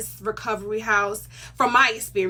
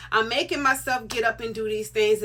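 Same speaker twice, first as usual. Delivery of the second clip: ordinary talk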